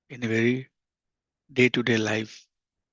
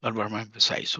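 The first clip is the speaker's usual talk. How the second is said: normal talking voice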